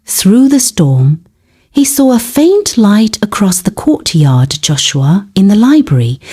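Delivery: ordinary delivery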